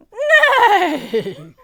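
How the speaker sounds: loud high falling neigh; whinny shrilly, shaking down in pitch; horse's whinny. A narrator's reading. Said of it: "said a horse".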